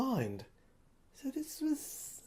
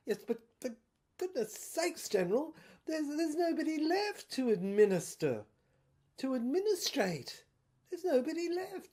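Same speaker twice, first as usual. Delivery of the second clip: higher, pleading, incredulous officer; exasperated protest; 7 semitones higher than usual. Acted. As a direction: throw it up high in disbelief. Superintendent protests to the General.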